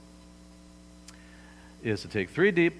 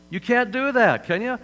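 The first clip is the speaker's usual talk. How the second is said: usual speaking voice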